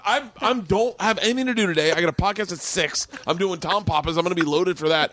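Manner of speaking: high-pitched comic griping; exasperated pleading. Retold or imitated. Retold argument for a drink.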